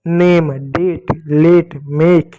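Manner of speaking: नेम, डेट, लेट, मेक spelled out slowly, each word drawn long; slow word demo. Shown as a demonstration. Teacher slowly models नेम, डेट, लेट, मेक for the children. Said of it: "ए की मात्रा पढ़ोगे".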